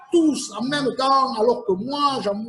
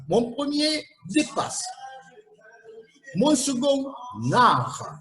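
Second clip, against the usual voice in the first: slow riddle reading; 3 semitones higher, slower than usual. Acted. Host reads riddle clues aloud.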